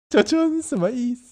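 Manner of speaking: lightly voiced child asking; mock child question. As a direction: ask it like a confused little kid. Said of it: "他说".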